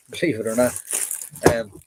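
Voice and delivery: usual speaking voice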